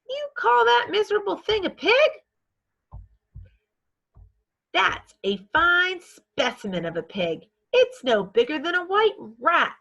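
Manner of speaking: put-on high, loud boy sneering at a runt; scornful boy. Brother Avery mocking the tiny pig, high sneering voice. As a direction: scoff at the pig like a know-it-all kid.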